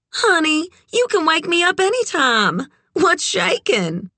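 very high, honeyed voice; extra sweet. A narrator's reading. Red reassures Kit who woke her, extra sweet. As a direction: coo it high to a welcome caller. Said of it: "extra sweet".

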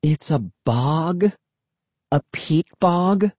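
slow, rising, exasperated repeat; impatient repeat. A narrator's reading. Emily repeating for someone slow, impatient. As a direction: spell it out slowly, pitch lifting at the end. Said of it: "she repeated impatiently".

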